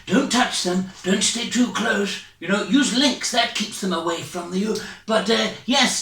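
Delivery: normal talking voice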